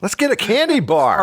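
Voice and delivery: high, bright announcer put-on, far above his own pitch; wannabe broadcaster voice. Mocking wannabe broadcasters, pointing at a passing car, high announcer voice. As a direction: hype a passing car like a radio host. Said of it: "I go".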